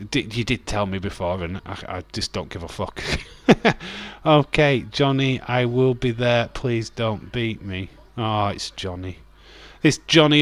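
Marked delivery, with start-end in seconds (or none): none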